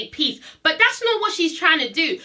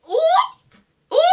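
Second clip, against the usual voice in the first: very high-pitched, drawn "ooh"; scandalized ooh; 4 dB louder than usual. Retold or imitated. Onlooker reacting to Phaedra's comeback, high scandalized oohing.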